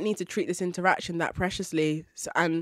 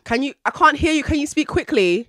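higher, louder dismissive demand; rude impatience; 7 semitones higher, 5 dB louder than usual. Retold or imitated. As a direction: brush off an unwanted approach rudely. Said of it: "I'd be so rude".

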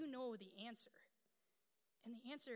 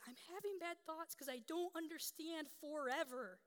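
put-on high, wailing little-girl voice; imitated hysterical child; 6 semitones higher, 4 dB louder than usual. Seven-year-old to her mother in the hallway, imitated hysterical distress. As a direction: imitate a child's meltdown over forever. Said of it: "she's hysterical".

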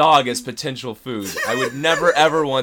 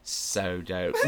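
low, soft Australian accent echo; 7 semitones lower, 8 dB quieter than usual. Shown as a demonstration. Guest trying the host's two-word trick, mock Australian accent. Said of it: "sometimes I sound Australian".

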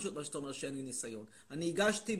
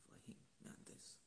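whispered mock aside; 24 dB quieter than usual. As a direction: breathe it as a secret.

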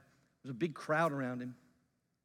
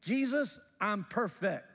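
slow, higher-pitched self-satisfied boast; pompous; 4 semitones higher, slower than usual. Acted. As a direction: play a smug young ruler, drawing it out. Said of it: "very pompously says".

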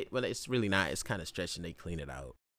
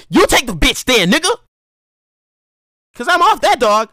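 loud retort; 9 semitones higher, 11 dB louder than usual. Retold or imitated. Retorting to homeboy about the dog.

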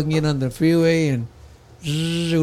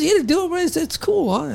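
much higher, coaxing bro voice; pushy friend; 13 semitones higher than usual. Retold or imitated. Friend pressing him to take the mushroom, high teasing bro voice.

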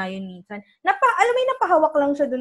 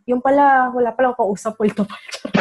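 ordinary talk

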